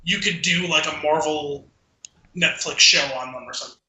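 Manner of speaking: ordinary talk, nothing marked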